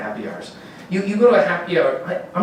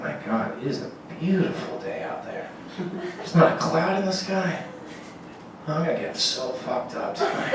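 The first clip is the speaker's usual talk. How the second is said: hushed awe; 2 semitones higher, 9 dB quieter than usual. Pretend. Alcoholic looking out window.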